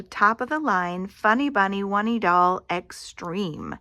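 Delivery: usual speaking voice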